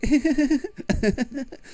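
high imitated giggle; put-on streamer laugh, very high; Swim. Player mimics a streamer always laughing, high giggling impression. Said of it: "just, like, laughing and stuff".

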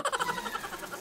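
high, breathless tittering; flies laughing. A narrator's reading. Flies laughing at stuck Morris, tittering. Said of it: "They laughed".

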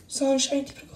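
hushed, gentle address; soft plea. Acted. Nick turns to injured Sunshine, soft and pleading. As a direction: say the name quietly, close by.

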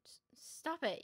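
breathless frightened whisper; scared whisper. Acted. Maria begging her friend to stop teasing, whispered. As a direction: hush it out in fear.

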